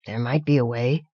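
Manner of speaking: low, quiet, emotion-clogged voice; choked with emotion. A narrator's reading. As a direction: hold back tears as you speak. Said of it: "his voice clogged with emotion".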